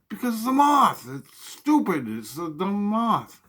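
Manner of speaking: high, loud, then slow insistence; exasperated yell. Retold or imitated. Son shouting at his mom that it's only a dumb moth. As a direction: hammer home that it's just a moth.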